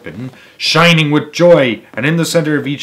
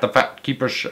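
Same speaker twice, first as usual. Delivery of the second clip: ordinary delivery, nothing marked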